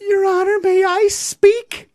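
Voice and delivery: high, slow pleading mom voice; imitating mother. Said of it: "And here's my mom".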